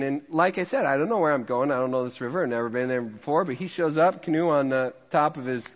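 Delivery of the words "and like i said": ordinary delivery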